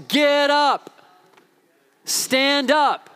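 high, commanding preacher's call; rally the congregation to its feet; rousing exhortation. Real, not acted.

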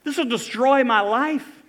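high, loud anguished pleading; desperate plea. Acted. Tamar begging Amnon, high and anguished. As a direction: cry out in shame and despair. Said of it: "she says".

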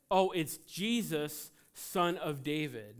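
slow emphatic retelling. Acted. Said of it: "retells the call slowly".

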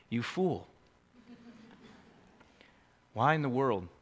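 hushed address to the congregation; quiet preaching. Acted. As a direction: speak it close and gently. Quiet applied section.

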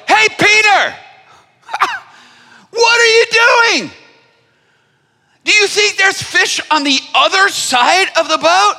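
high, loud jeering taunt; hammed-up mockery from the shore; mocking fishermen. Fishermen on shore jeering at Peter loudly. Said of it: "Looking over and going".

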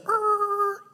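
very high squeaky screech of a halt; record-scratch er. Shown as a demonstration. Preacher mimics a record scratch, high and squeaky. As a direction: make the sound of the story skidding to a stop. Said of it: "all of a sudden it's a".

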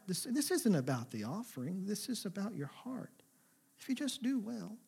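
soft, tender voice of a caring father; softly voiced loving God asking his son; gentle loving God. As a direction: coax him gently, almost hushed. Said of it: "so caring and so loving".

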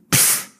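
a short dismissive pfft; cynical scoff. Retold or imitated. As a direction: blow out a scornful pfft. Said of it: "Would you be cynical?".

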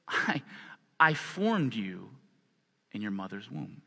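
soft, slow, intimate divine words; tender voice of God. Acted. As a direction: speak it gently, like a father. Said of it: "He says".

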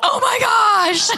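high, crying half-shout of mock panic. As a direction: wail it like a comic meltdown. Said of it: "that's just the way my mind sees it".

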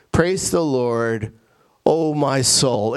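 slow, deliberate quotation of a psalm; measured verse reading. Shown as a demonstration. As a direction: read the verse out slowly and solemnly. Reads the psalm verse first.

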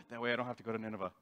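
mock Jonah revealing his hidden motive; sly aside. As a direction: slip out the real motive under your breath.

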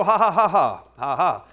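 low, deliberate mock laughter; forced laugh. Shown as a demonstration. Preacher showing laughing by faith at the mirror, forced laugh. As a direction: make yourself laugh, ha by ha. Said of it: "you have to laugh by faith".

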